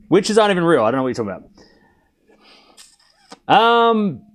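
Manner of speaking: ordinary talk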